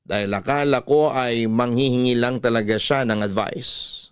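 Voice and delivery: ordinary talk, nothing marked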